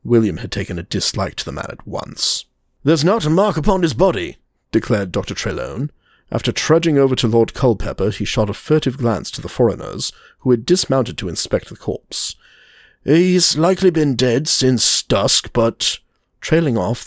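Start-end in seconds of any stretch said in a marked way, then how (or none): 2.8-4.4 doctor's voice. Acted. 13.1-16.0 doctor's voice. Acted.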